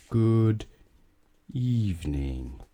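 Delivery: evening stretched out slowly; slow greeting. Acted. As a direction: drag the greeting out. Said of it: "said Mister Slow".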